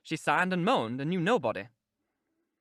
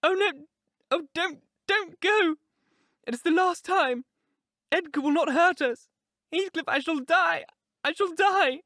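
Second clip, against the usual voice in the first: high, frantic, loud wailing plea; desperate woman crying out; shrieking; 12 semitones higher, 5 dB louder than usual. A narrator's reading. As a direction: beg hysterically at the top of the voice. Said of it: "she shrieked".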